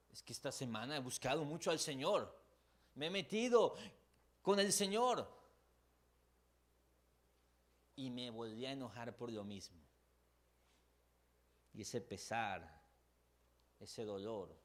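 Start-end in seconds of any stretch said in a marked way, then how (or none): 0.1-9.8 frustrated believer. Retold or imitated. Preacher voices frustrated believer.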